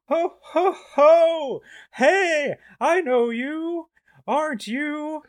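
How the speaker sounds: very high loud Santa voice; Santa Claus. Pretend. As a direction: boom a high hammy Santa laugh. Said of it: "I am playing the roles of Santa Claus".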